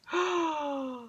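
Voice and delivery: sharp frightened gasp; sudden audible intake of breath; shocked gasp. Pretend. The whole crowd freezes in shock.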